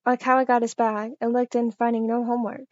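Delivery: ordinary delivery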